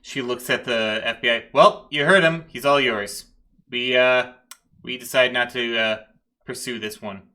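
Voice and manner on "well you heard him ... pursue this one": loud, brisk-spirited lieutenant; raised-voice officer washing her hands of it; lieutenant handing off. Acted. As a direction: pass the problem on emphatically. Lieutenant hands case to FBI.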